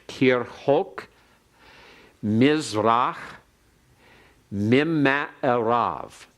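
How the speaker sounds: slow, careful Hebrew, each word set apart; slow teacher's Hebrew recitation; Hebrew read aloud. Shown as a demonstration. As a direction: model the Hebrew for students to copy. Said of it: "you can repeat it after me".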